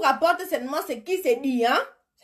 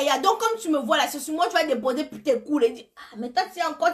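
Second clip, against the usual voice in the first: normal talking voice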